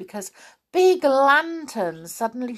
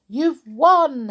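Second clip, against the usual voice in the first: calling out; 6 semitones higher, 6 dB louder than usual. Acted. Saucepan man wins race.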